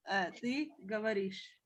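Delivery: usual speaking voice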